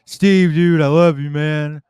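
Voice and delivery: loud, high, gushing "I love you"; stoned gushing friend. Retold or imitated. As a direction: blurt it out like a tripping buddy. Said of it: "I just remember you like".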